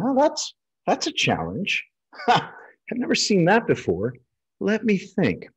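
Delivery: a surgeon's inner delight at a puzzle; intrigued inner voice. Retold or imitated. Surgeon thinking to himself about an odd case, intrigued and amused. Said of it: "You're thinking".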